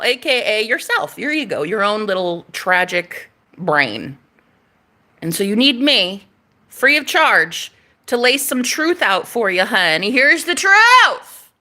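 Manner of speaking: usual speaking voice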